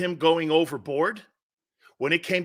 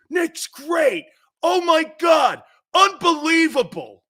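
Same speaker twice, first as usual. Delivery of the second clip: loud, high, hammed-up raving; over-the-top fake amazement, high and loud; mock gushing praise; 10 semitones higher, 6 dB louder, slower than usual. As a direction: sarcastically gush like a company man, shouting it. Mocking the company man's praise.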